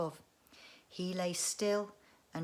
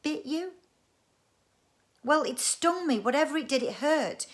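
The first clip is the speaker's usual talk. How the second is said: incredulous; 8 semitones higher, 7 dB louder than usual. Acted. Siblings argue over the sting.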